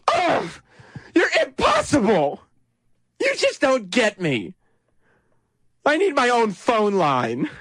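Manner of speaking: high, hammed-up teen whine; exasperated teenager. Host acts out candidate as teen defying a parent, exasperated teenager. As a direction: huff it like a misunderstood teen. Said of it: "acting like a teenager".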